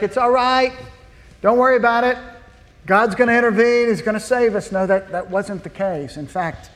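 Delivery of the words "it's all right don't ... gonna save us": higher, breezy all is well; mock reassurance. Israelites at the Red Sea shrugging off fear, breezy mock calm. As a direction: cheerily brush off the danger. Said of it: "they're like".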